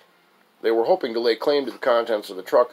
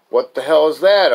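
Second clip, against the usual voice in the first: raised, loud demand to know; a baffled outburst, pitched up; startled question; 6 semitones higher, 8 dB louder than usual. Acted. Narrator asks friend about noise under truck, startled. Acted question in roadside story.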